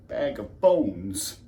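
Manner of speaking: slow, drawn-out repeat; dejected echo. Acted. Husband repeating wife's name for him, dejected. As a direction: dwell slowly on the hurtful name.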